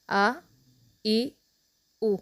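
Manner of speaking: "आ", "ई", "उ" each alone with a pause between; paused separate vowels. Shown as a demonstration. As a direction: read every vowel apart, pausing. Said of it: "ठहर ठहर कर पढ़ेंगे". ("आ ई उ" ठहर ठहर कर)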